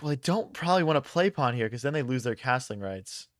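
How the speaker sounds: ordinary delivery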